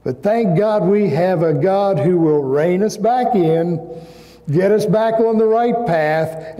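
ordinary talk

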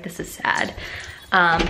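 normal talking voice

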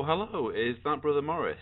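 ordinary talk, nothing marked

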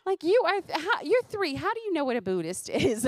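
high, loud incredulous reaction; amazed disbelief. Real, not acted. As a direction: react in stunned amusement.

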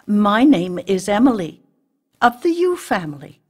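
high, loud self-introduction; proud introduction. A narrator's reading. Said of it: "she couldn't resist adding".

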